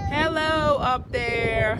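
drawn-out high, loud sing-song call. Real, not acted. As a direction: stretch it out and sing it upward. Calling up to child on play tower.

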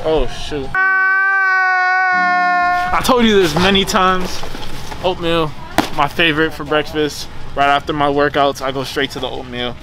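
ordinary talk

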